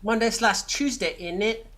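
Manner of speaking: half-hearted Cockney by way of Sydney; bad Cockney accent. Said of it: "that was the worst accent".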